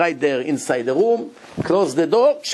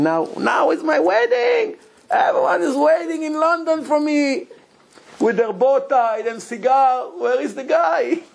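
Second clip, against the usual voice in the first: panicked groom; 12 semitones higher than usual. Retold or imitated. Groom's panicked thoughts in locked room.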